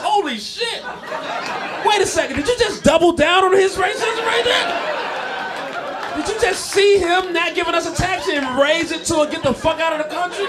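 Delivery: stunned, can't believe it; yelling in disbelief at the bystander; shocked disbelief. Retold or imitated. Shocked reply to racist bystander.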